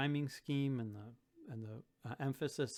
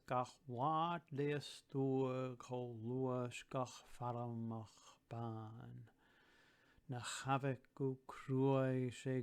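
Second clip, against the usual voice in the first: slow, measured Gaelic verse recital with stressed key words; rhythmic recital; slower than usual. Shown as a demonstration. Teacher reciting a Gaelic verse to students, slow and rhythmic. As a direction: recite it slowly, leaning on the rhyming words. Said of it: "lines with that little bit of emphasis and a little bit of, of rhythm".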